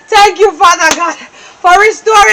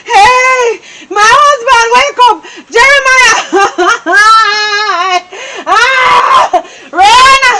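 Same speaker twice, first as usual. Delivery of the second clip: wailing plea; 3 semitones higher than usual. Acted. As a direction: wail and beg through tears. Wife wailing over unconscious husband.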